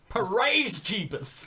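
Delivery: high, slow, hammed-up praise; drawn-out mock preacher cry; televangelist shout.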